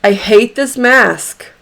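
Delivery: "I hate this mask" with firm weight on "mask"; emphatic. Shown as a demonstration. Coach has listeners state their true feeling, emphatic. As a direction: punch out "I hate this mask", falling at the end. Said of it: "And I'm emphatic on purpose".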